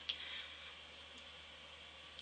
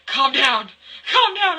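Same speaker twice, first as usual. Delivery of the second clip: rising, louder calm down; calm down, getting more insistent; telling her to calm down; 6 semitones higher, 7 dB louder, slower than usual. Retold or imitated. Friend retold telling her to calm.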